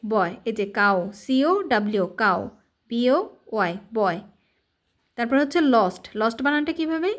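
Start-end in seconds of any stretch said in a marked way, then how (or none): none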